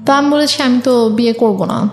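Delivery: ordinary delivery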